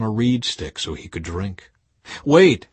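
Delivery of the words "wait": sudden call. Acted. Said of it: "he said".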